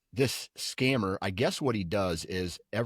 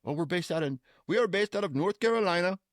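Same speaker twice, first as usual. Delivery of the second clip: mock Indian accent; 9 semitones higher, faster than usual. Scammer answers in voiced accent.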